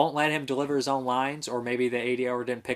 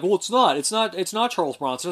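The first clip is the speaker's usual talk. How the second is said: mock defensive fan; 7 semitones higher, 4 dB louder than usual. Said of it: "people are like".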